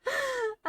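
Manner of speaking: high, loud shaky laugh; startled laugh. Real, not acted. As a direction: burst into a nervous laugh. Startled by friend in shelter.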